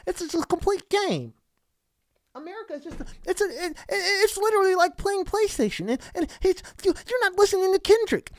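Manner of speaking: over-explain it breathlessly like a superfan; mock whiny fan. Mocking a [name] fan insisting the show was a game metaphor, high and whiny.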